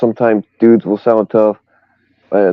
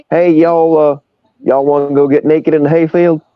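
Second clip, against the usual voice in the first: put-on Southern Indiana accent; 6 semitones higher than usual. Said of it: "you know, they say".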